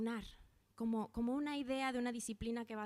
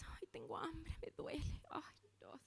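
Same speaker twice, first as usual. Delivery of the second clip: quejido aniñado en susurro, más agudo y suave; queja de hambre fingida; 5 semitones higher, 8 dB quieter than usual. Retold or imitated. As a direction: gimotear como quien no aguanta el ayuno. Imita al que ayuna quejándose. (queja exagerada de hambre, susurrada y aguda)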